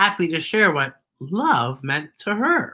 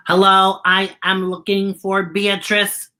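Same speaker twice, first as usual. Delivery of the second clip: even-pitched, word-by-word robot voice; monotone robot Z; 2 semitones higher than usual. Acted. Robot explaining his search for Beatrice, in an even monotone. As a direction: speak like a polite robot on one note.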